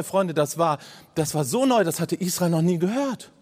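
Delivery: ordinary talk, nothing marked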